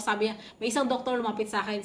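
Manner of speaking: ordinary delivery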